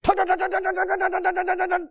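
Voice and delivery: high, rapid monotone rattle; imitation machine gun made of words; machine-gun talk. Shown as a demonstration. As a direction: fire it off in one unbroken high burst. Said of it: "like a machine gun".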